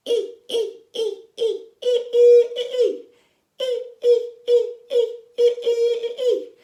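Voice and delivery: very high, loud monster squeals; scary monster sound. Shown as a demonstration. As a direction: squeal short, piercing monster cries. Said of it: "our scary monster sound goes".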